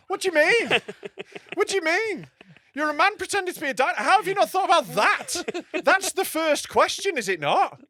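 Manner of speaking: high, loud incredulous retort; exasperated disbelief. Retold or imitated. Comic challenging the dinosaur act, high and exasperated. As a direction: challenge him in mock outrage, pitch way up.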